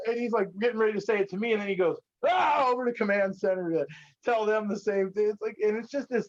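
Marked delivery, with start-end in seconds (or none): 2.3-2.7 imitating a startled shout; mock yell. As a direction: yell it like an excited old camper. Old guy calls to command center.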